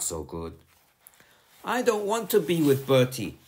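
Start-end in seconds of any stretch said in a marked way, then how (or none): 1.6-3.4 sulky. A narrator's reading. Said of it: "sulked Sandra".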